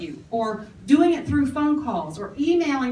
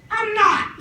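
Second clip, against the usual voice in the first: normal talking voice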